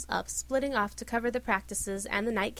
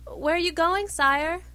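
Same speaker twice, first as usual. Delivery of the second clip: higher, louder call; 7 semitones higher, 7 dB louder, slower than usual. A narrator's reading. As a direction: call after the departing prince. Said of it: "called Tristan".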